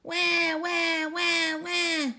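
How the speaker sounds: put-on high wailing baby cry. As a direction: wail like an unhappy baby. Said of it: "started to cry".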